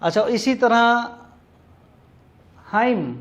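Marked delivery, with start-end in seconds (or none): 2.6-3.2 हाइम said as him, n silent. Shown as a demonstration. Teacher demonstrates hymn to class, silent n. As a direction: say हाइम with no final n. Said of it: "यानी हमद".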